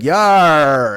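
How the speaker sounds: loud pirate "Yar!"; movie ants roaring "Yar!"; ant pirate yell. Retold or imitated. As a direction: shout it like a giant ant pirate. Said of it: "And they're like".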